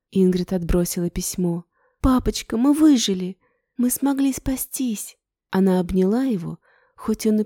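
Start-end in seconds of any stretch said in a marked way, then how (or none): 2.0-5.2 excited. Acted. Joyful reunion cry.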